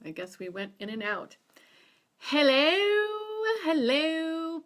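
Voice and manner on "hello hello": high, loud call of hello to viewers; loud greeting. Real, not acted. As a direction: call out hello brightly to the audience. Greeting live stream viewers.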